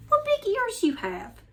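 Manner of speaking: put-on high child's voice, amazed; wondering little girl. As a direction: marvel at the big ears.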